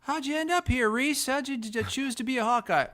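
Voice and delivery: very high-pitched interviewer voice; high, hammed-up questioning; mock reporter. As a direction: put on a squeaky reporter asking the recruit. Replaying reporter's question to the punter.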